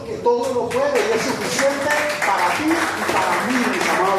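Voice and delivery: high, loud pulpit proclamation; fervent preaching. Acted. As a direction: preach it out to the congregation. Preaching at full force. (predicación fervorosa y en voz alta)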